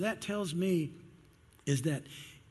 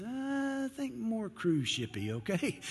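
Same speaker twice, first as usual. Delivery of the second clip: higher, slow, drawn-out cruise shippy; a mock-grand Noah, much higher and slow; playful Noah; 7 semitones higher, slower than usual. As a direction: stretch it out like a proud joke. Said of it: "he says".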